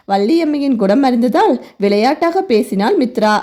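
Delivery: usual speaking voice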